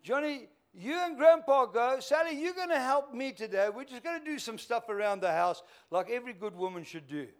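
calling out. Acted. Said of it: "she called out and said".

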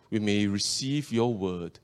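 ordinary talk

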